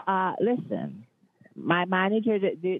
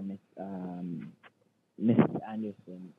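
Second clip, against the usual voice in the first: much lower, put-on manager voice; fake manager voice; 9 semitones lower, slower than usual. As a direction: drop the voice to play the boss. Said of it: "switch your voice to the manager".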